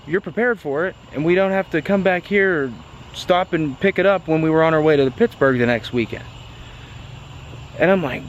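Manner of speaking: ordinary delivery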